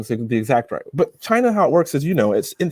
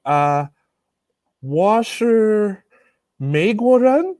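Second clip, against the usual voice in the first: put-on halting Chinese with a thick American accent; broken Chinese, heavy accent; 6 semitones higher, much slower than usual. To the immigration officers, broken Chinese in a thick foreign accent. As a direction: fumble the Chinese like a clueless tourist. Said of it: "really broken Chinese".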